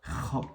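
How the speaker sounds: "Kha" with a rasped back-of-throat fricative; Arabic kha. Shown as a demonstration. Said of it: "qui n'existe pas en français". (« Kha » avec un kh raclé, à l'arabe)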